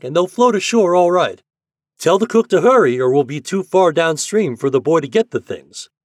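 high, loud, hearty commands; captain giving orders. A narrator's reading. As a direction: order the crew about with urgent goodwill.

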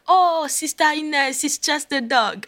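high, drawn-out soothing imitation; slow, high-pitched mock reassurance; reassuring pastor. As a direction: play the pastor brushing off her worry. Pastor soothing her, acted.

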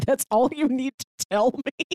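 ordinary delivery

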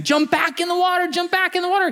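high urgent calls to a friend; calling out. Acted. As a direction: shout him back into the water. Friends calling to dying fish.

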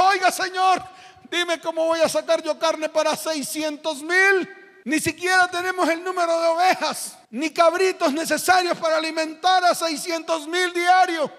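high, pleading complaint; Moses protesting. Acted. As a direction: argue back at God, exasperated. (Moisés quejándose)

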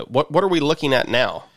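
normal talking voice

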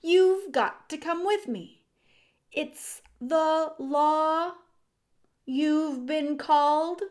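exasperated scolding. A narrator's reading. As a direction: press the point on a wayward brother. Exasperated brother scolding Despereaux.

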